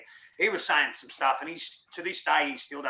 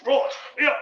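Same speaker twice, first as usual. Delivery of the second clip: high, loud bush drawl; Mick's voice; 13 semitones higher, 7 dB louder than usual. Retold or imitated. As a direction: crow it like a cheery bush bloke. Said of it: "That's how he used to speak".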